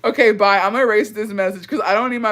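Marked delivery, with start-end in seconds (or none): none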